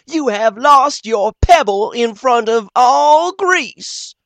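high, loud, slow teasing; mocking. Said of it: "playing with Billy".

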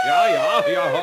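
sehr hoch und laut gejault; heulender Wind. Acted. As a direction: jaulen wie der Sturm. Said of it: "jetzt musst du Hui machen". (Sturm heult hoch in die Segel)

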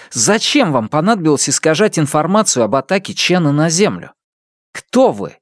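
lightly voiced, rising young accuser; accusing young man. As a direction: press him hard with questions. Isidore accuses the shadow.

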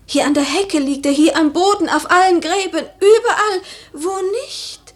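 building from quick to loud and high; rising agitation. Acted. Lady feeling the dew everywhere, rising into agitation.

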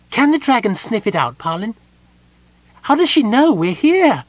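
high kender voice, uneasy and squirmy; a nervous little kender guessing at the curse; high, uneasy kender worrying aloud; kender Tasselhoff. A narrator's reading.

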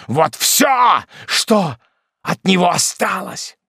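loud, slow menacing command; terrible voice. A narrator's reading. Hermit shows the widow her son's skull, loud and fierce. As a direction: force her to look at the skull. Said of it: "страшным голосом".

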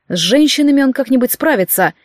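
ordinary delivery

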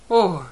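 a loud alarmed "oh"; cry out. Acted. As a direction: yelp it in fright. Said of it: "закричал Гроувер".

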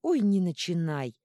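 loud, slow exasperated outburst; explode, dragging it out; flaring up. A narrator's reading. Ёж flaring at his bride's reproach, very loud. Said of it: "взвился Ёж".

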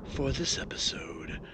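low spooky horror host voice; jack o lantern voice. Acted. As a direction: drawl it out like a ghoulish host. Spooky cold open before host intro.

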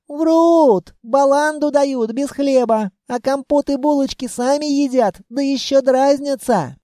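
put-on high, emphatic child's outburst; indignant child. A narrator's reading. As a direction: complain indignantly in a little girl's voice. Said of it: "возмутилось дитя". (возмущённо, высоким детским голосом)

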